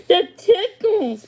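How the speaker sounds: slow, high, loud, laughing; giggling. A narrator's reading. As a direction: laugh through the words. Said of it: "Pippa giggled".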